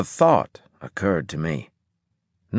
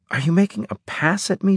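indignant; 9 semitones higher, faster than usual. A narrator's reading. Indignant disbelief.

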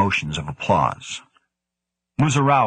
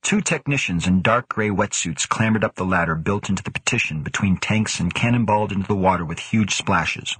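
ordinary delivery

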